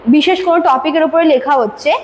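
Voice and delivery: ordinary talk, nothing marked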